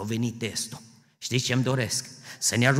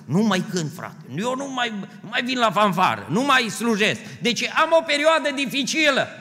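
mock complaining believer; 11 semitones higher, 6 dB louder than usual. Mocking a quitting believer.

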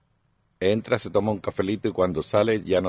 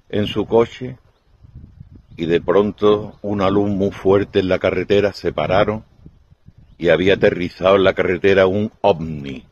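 ordinary delivery

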